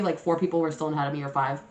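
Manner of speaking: normal talking voice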